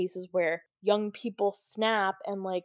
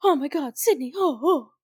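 lightly higher, pleading mock concern; fake worried Billy; 6 semitones higher than usual. Billy faking panic over Sydney, mock worried. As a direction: play the frightened boyfriend act. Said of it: "Billy completely changes his key from".

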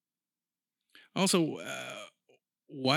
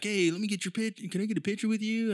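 higher-pitched imitation of an eager fan; pushy fan; 7 semitones higher than usual. Mimicking dudes who ask fighters for pictures, pushy fan. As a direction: mimic a fan pestering for a photo. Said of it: "one of those dudes to be like".

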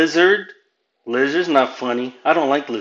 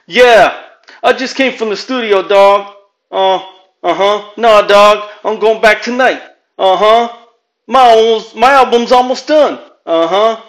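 high, loud wannabe-rapper bragging on the phone; loud phone brag; 7 semitones higher, 6 dB louder than usual. Retold or imitated. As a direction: boast to a buddy loud enough for the room. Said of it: "a guy on the phone talking loud".

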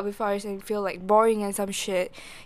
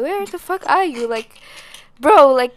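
loud, higher-pitched exasperated mock yell; retold screaming; 5 semitones higher, 7 dB louder than usual. Sister retelling screaming at her lost brother, loud mock yell. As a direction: re-enact yelling down the phone, not a full scream. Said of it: "I was, like, screaming too".

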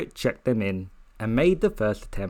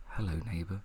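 soft breathy-free whisper, much softer; whispered hello; 3 semitones lower, 11 dB quieter than usual. Retold or imitated. She greets her neighbour at the door in a soft whisper. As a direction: murmur it low and soft like a secret. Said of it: "she whispered and was like".

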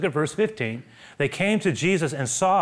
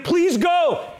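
urgent plea; 14 semitones higher, 4 dB louder than usual. Acted. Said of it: "they were begging him".

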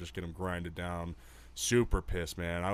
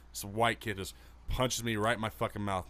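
ordinary delivery

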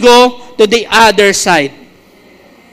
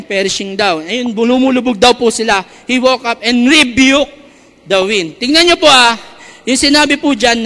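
usual speaking voice

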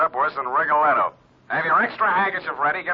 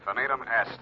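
quiet Latin; 6 semitones lower, 7 dB quieter than usual. Acted. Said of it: "It is finished".

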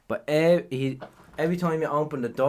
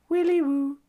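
high sing-song imitation of a shop bell; doorbell jingle impression; 12 semitones higher than usual. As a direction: chime it like a recorded doorbell. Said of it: "all you hear is".

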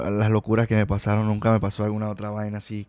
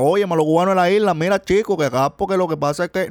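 high, lively mock Cuban accent; playful put-on Cuban accent; 7 semitones higher than usual. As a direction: imitate a cheerful Cuban from the island. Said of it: "yo pensaba que los cubanos eran".

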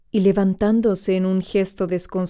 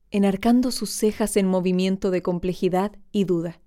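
ordinary delivery

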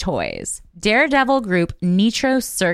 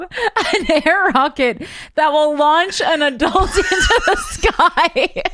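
high, slow, laughing reading; cracking up mid-sentence; laughing while reading; 8 semitones higher, slower than usual. Real, not acted.

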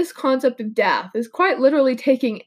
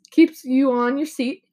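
ordinary talk, nothing marked

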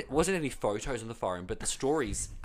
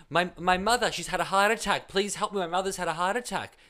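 desperate plea; 6 semitones higher, 6 dB louder than usual. Retold or imitated. Stranger begging for help.

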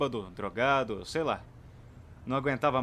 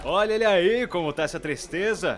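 teasing; 5 semitones higher, 6 dB louder than usual. Acted. Mocking greeting to friend.

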